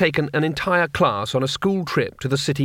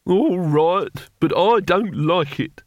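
put-on higher, slow, grudging old voice; reluctant old man; 5 semitones higher, much slower than usual. A narrator's reading. Old Vincent giving in to the plan, grudging old voice.

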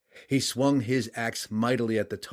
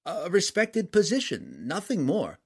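nervous merchant; 8 semitones higher than usual. A narrator's reading. Merchant continues his plea.

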